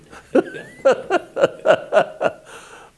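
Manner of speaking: high, loud burst of laughter; big laugh. Real, not acted. Storyteller cracks up at his own punchline, laughing loudly.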